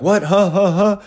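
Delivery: high baffled huh-huh-huh from lost players; mock confused teenage huh. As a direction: mimic players caught off guard. Confused players in retold drill.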